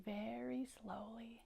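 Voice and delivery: slow, hushed words; soft and dragging; very slowly. A narrator's reading. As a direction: stretch it out softly, almost whispered. Showing how slowly he goes.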